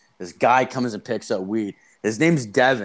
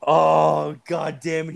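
higher-pitched groan of dismay, louder at the start; exasperated, pitched-up curse; retold dismay; 6 semitones higher, 5 dB louder, slower than usual. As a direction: act out the moment you realize you've been robbed. Retells reaction to being ripped off.

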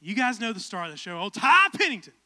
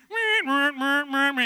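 mock meowing; 3 semitones higher, 6 dB louder, much slower than usual. Imitated background noise.

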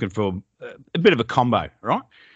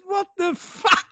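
high, slow, laughing "what the fuck"; retelling his mate cracking up; laughing mock disbelief; slower than usual. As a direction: squeal it out through laughter. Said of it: "he starts laughing".

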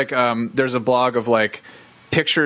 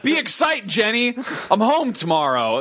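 excited; 6 semitones higher, slower than usual. Retold or imitated. Retold excited text to Jen.

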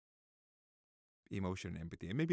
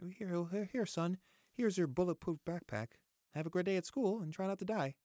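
mock cheerful parent; 7 semitones higher, 4 dB louder than usual. Mock parent handing backpack.

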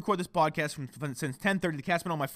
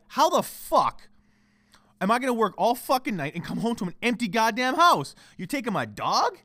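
loud, high, indignant rant; re-enacted furious complaint; angry outburst; 7 semitones higher, 5 dB louder than usual. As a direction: blow up at being left without the dog. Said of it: "I get, I'm, I get angry".

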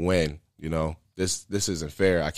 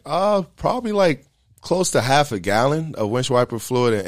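throat burned raw; 4 semitones higher, 4 dB louder than usual. Retold or imitated. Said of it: "I'm not talking this clear".

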